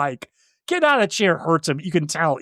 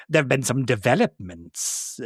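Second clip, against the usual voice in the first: sly Pretorius; 4 semitones lower, 4 dB quieter than usual. Retold or imitated. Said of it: "Pretorius says".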